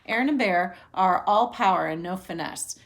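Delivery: plain reading voice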